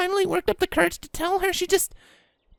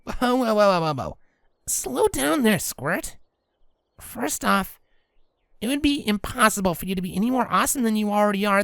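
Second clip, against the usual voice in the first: lower, easygoing reassuring voice; calming Rainbow Dash; 9 semitones lower than usual. Acted.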